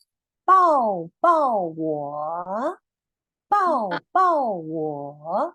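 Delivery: very slow, loud, clear 抱抱我; slow model phrase. Shown as a demonstration. Teacher models the Mandarin for children to repeat. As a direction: say 抱抱我 syllable by syllable, slowly. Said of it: "Just listen".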